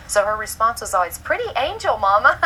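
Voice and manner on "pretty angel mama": put-on high childlike squeal, louder on Mama; little girl voice. Mother voicing her daughter's usual reply, high child voice. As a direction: sing it out like a delighted four-year-old.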